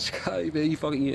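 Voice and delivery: high, playful buddy jab; friendly ribbing. Retold or imitated. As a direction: tease a friend after making up. Friendly banter after fights.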